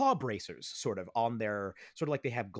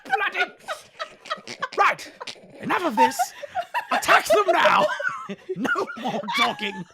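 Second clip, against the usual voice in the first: much higher, louder, laughing yell; furious order; 10 dB louder than usual. Acted. Leader yelling at his troops to attack, laughing. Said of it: "the one with the lion skull says".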